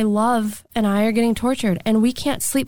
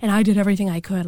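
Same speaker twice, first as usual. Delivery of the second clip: plain reading voice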